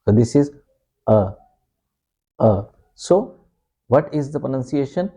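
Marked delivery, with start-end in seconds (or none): 1.1-2.6 "a" as a short relaxed UH; brief UH for "a"; schwa. Shown as a demonstration. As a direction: say "a" as a bare schwa. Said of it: "this A gives a schwa sound".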